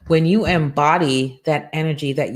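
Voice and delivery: ordinary delivery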